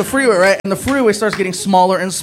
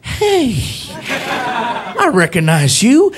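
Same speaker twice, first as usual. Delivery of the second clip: much higher, slow starstruck policeman; excited cop; 7 semitones higher, slower than usual. Retold or imitated. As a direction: gush at the star. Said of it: "He's like".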